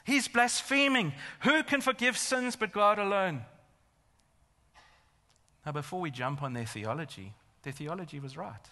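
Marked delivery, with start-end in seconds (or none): 0.0-3.5 high, loud acted outrage; raised, forceful voice of an indignant accuser; outraged accusation. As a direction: cry blasphemy as an offended religious teacher. Acts out outraged teachers of law.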